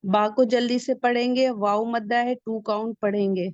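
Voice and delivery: normal talking voice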